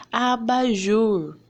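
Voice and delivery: abajur said very slowly, last syllable jur stressed; stressed example word. Shown as a demonstration. Teacher modelling example words for the pupils. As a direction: stretch abajur out, landing on jur. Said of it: "qual que é a sílaba mais forte".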